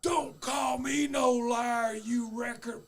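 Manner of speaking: slow furious yell, imitating Sam; angry shout. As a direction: bellow it slowly at the man who called him a liar. Retold fight, Sam exploding.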